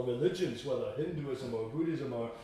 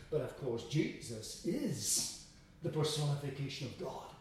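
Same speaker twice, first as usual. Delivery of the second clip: ordinary delivery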